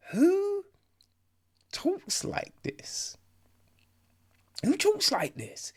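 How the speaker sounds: high, slow incredulous voice; hammed-up high-pitched dismay; mock disbelief. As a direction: ask it in strained, airy bafflement. Acting out baffled viewer's reaction.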